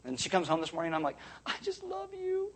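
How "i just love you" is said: very high, soft, slow, swooning; doting voice. Retold or imitated. Husband greets his wife coming home, in a high, soft, doting voice. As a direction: gush it out in a tiny besotted voice. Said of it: "She likes it when I'm like that".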